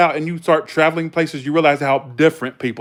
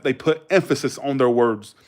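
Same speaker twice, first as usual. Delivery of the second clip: normal talking voice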